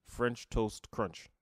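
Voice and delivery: slow and deliberate, every word clear; slow, careful. Shown as a demonstration. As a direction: spell out crunch carefully and slowly. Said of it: "but it's crunch".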